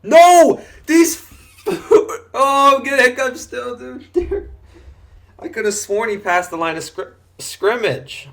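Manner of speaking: high, loud, laughing protest; excited outburst. Real, not acted. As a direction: yelp it out, laughing at the bad play. Frustrated at play, laughing through hiccups.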